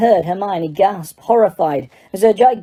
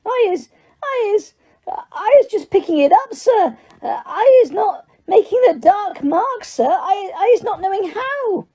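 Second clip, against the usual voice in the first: very high, tearful squeak; squealing elf; 13 semitones higher than usual. A narrator's reading. Elf in tears protests to Diggory, squealing. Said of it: "tears streaming down the sides".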